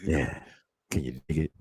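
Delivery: very deep, hammed-up seducer voice; Chris Pratt. Host imitating a man wooing with movie lines, deep seduction. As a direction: drag it out low like a smooth pickup line. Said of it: "let me try to sound like Chris Pratt".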